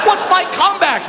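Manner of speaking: ordinary delivery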